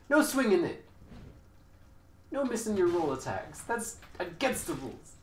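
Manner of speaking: high, loud hammed-up scolding; mock angry. Gamer hamming up anger at the opposing player. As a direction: scold the opponent in mock fury. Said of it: "I wanna be angry".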